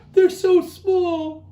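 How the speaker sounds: high squeaky chipmunk wail with a laugh. A narrator's reading. Chipmunk crying over his small feet, high wail. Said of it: "Chipmunk wailed".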